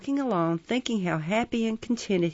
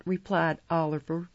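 usual speaking voice

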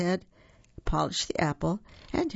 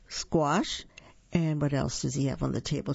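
ordinary delivery, nothing marked

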